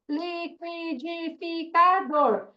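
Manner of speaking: liquidificador stretched slowly, syllable by syllable. Shown as a demonstration. Teacher to class, sounding out a long word. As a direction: drag out each syllable of liquidificador. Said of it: "palavra de cinco sílabas".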